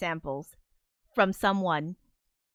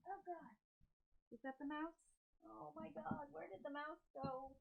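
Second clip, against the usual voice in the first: softly muttering while searching under the desk; quiet, panicked mumble away from the mic; 7 semitones higher, 25 dB quieter than usual. Real, not acted. Streamer hunting for a dropped mouse, muttering off mic.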